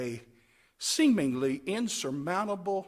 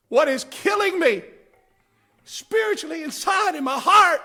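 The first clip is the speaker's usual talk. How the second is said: high, loud cry of pain; anguished shout; 11 semitones higher, 9 dB louder than usual. Retold or imitated. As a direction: cry it out from the heart. Anguished cry in quoted thought.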